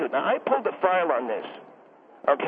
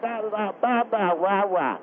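babbling like an infant; baby talk; 2 semitones higher, slower than usual. Acted. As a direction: gurgle like a baby. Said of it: "I'm allowed to baby talk".

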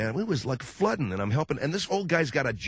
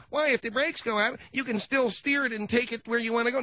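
put-on kid arguing; 10 semitones higher than usual. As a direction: argue like a stubborn child.

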